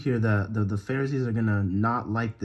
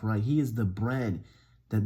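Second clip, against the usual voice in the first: ordinary talk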